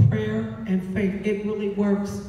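ordinary talk, nothing marked

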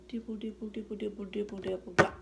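rapid pattering; fast rain patter. Pretend. Reading a picture book, pattering fast like rain. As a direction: rattle it off like rain. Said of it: "He can sound like rain".

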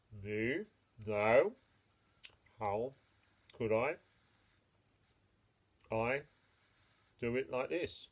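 a hint of a lazy chewing cow. The cow chewing cud, answering the mole, low and unhurried.

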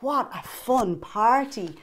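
ordinary delivery

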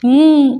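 a quick high hum that drops off; mm, agreeing to everything she lists; humming assent. A narrator's reading. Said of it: "ఊ కొడుతున్నాడు".

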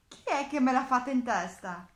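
loud, indignant little mole; indignant exclamation. A narrator's reading. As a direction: demand loudly who did it. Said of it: "esclamò la piccola talpa".

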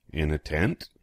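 usual speaking voice

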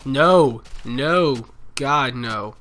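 loud, alarmed cries; panicked no. Real, not acted. Gamer spotting a creeper too late, panicked yell.

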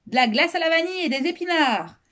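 put-on high-pitched kid bellowing; bawling. A narrator's reading. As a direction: holler it down high and brash. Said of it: "brailla Sophie".

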